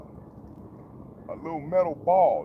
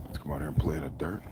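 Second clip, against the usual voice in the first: hushed whisper to the animals; 11 semitones lower, 9 dB quieter, faster than usual. Real, not acted. Whispering to his animals in the yard, hushed. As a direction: breathe the words, coaxing. Talking softly to animals outside.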